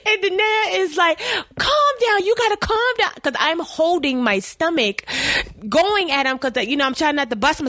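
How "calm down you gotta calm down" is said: urgent calm down. Retold or imitated. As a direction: cry out to settle her, high and pressing. Friend restraining her, retold.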